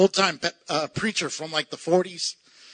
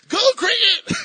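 high, loud mock order; mock command; 12 semitones higher, 5 dB louder than usual. Preacher jokingly ordering a cricket out of his house. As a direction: shoo it off with a joke.

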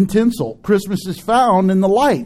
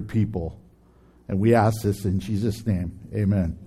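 prayer; 6 semitones lower than usual. Acted. Leads a closing prayer.

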